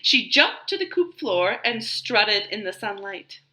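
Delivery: ordinary delivery, nothing marked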